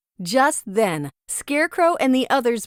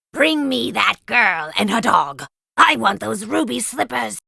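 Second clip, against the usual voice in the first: louder, hammed-up witch voice; wicked witch; 4 dB louder than usual. Witch gives order to monkeys.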